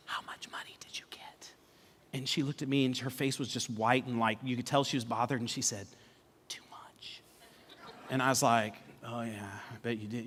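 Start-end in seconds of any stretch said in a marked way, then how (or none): 0.1-1.5 whispered, conspiratorial question to wife; secretive whisper. Retold or imitated. Husband secretly asks wife about the money, whispering. 6.5-7.2 barely audible whispered reply; bothered whisper. Retold or imitated. Bothered wife answers her husband about the money, whispering. 9.0-10.2 low, very soft reply; under his breath. Retold or imitated. Husband privately answers his bothered wife, under his breath.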